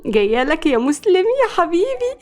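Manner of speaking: high-pitched giddy coo; excited, lovestruck. A narrator's reading. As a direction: call out dreamily to your sweetheart. Lovestruck girl calls to her crush.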